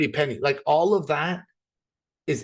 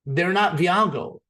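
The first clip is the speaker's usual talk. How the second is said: usual speaking voice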